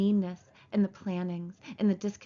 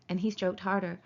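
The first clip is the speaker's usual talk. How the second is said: ordinary delivery, nothing marked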